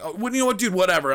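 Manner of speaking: high-pitched retold brush-off; exasperated dismissal. Ending argument with driver, exasperated dismissal.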